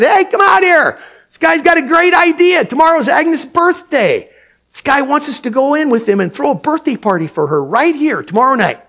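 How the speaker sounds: loud excited call to the back room; shouted call. Acted. Diner owner shouting to his wife in back. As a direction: shout it out to the kitchen. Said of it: "he shouted".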